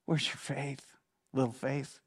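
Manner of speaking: low, very quiet question; soft chiding. Retold or imitated. Jesus to a doubting believer, chiding very softly. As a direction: ask it gently under your breath. Imagines Jesus gently addressing him.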